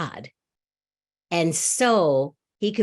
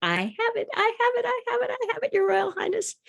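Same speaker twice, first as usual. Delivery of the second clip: much higher, excited courtier voice; excited advisor. Acted. Second advisor bursting to tell the king, high and excited. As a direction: squeal it out like an eager advisor. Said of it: "the next advisor says".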